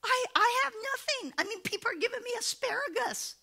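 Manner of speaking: high, mock tearful whine; hammed-up crying complaint; whining preacher. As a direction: whimper out a helpless excuse. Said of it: "And he said".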